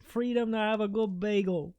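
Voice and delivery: stretched-out mock Boston accent; hammed-up Boston vowels. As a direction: parody a proud Bostonian. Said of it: "sorry to everybody from Boston".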